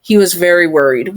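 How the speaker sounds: usual speaking voice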